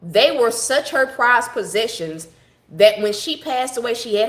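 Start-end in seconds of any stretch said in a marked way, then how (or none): 0.0-2.3 slow, stressing her and prized; slow emphatic. Real, not acted. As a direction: lean hard on such her prized possessions. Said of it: "when I say these dogs were her prized possessions".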